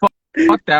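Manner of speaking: high, loud retold outburst of anger; retelling a heated refusal at the border; angry outburst. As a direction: spit it out in a burst of outrage.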